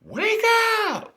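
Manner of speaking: very high, loud shriek; screamed wake up. Retold or imitated. Teacher screaming in the sleeping student's ear, shrieking. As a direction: scream it right in his ear. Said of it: "came right in my ear and was like".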